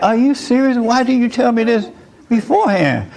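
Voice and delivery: high, panicky pleading; sweating groom begging to know why he wasn't told, high panicked voice; nervous groom. Retold or imitated. Hammed-up nervous groom. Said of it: "He's like".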